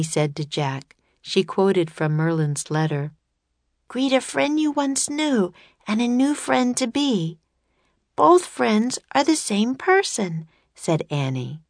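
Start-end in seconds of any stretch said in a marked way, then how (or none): 3.9-10.5 put-on high young girl. A narrator's reading. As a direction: voice Annie as thoughtful. Annie voiced by narrator.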